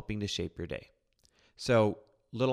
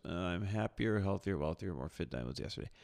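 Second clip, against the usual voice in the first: groggy half-asleep; 4 semitones lower, 6 dB quieter than usual. Retold or imitated. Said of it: "it sounds something like this".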